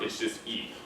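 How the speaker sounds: usual speaking voice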